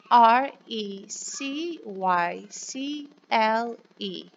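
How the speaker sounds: put-on drawn-out letter-by-letter spelling for children; slow spelling. Shown as a demonstration. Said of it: "can you say it with me".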